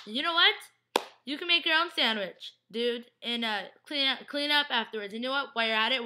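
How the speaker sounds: indignant retold rant; mock telling-off. Acting out a telling-off.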